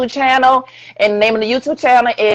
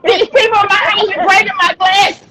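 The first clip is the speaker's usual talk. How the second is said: high-pitched exasperated protest; exasperated complaint; 8 semitones higher than usual. Real, not acted. Same comic rant, repeated complaint.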